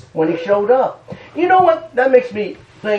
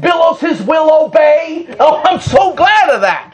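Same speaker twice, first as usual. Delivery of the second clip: preaching shout; 5 semitones higher than usual. Real, not acted. Preacher praising the master of the sea, ringing out. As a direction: lift it up like a hallelujah.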